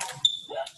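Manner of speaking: high unvoiced gasp of fright; startled intake of breath; sharp gasp. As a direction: gasp as the monster appears. Mouse meets gruffalo.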